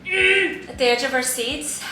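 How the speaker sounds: normal talking voice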